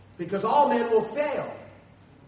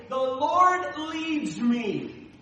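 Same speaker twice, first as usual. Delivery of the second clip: very slow, higher, louder The Lord leads me; slow, emphatic declaration; 7 semitones higher, 4 dB louder, much slower than usual. Retold or imitated. Pastor voicing a humble believer, slow and emphatic. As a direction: stretch out every word, Lord stressed. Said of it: "would say".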